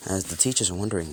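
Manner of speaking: plain reading voice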